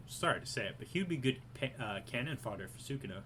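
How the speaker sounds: usual speaking voice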